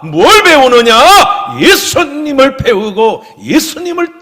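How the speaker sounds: loud, high-pitched exhortation in swelling waves; full-force preaching. Real, not acted. Pastor exhorts the congregation to follow Jesus, impassioned loud preaching. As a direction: preach it out loud, stretching the key phrases.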